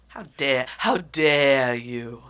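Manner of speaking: slow, dramatic how dare you; drawn-out mock outrage from a little girl; imitating her daughter. Mother imitating her daughter's indignant how dare you. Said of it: "That's how my daughter talks".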